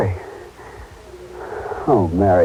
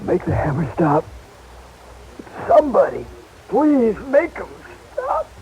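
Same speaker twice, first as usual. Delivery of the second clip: pained moaning; 5 semitones higher than usual. Acted. Patient in head pain.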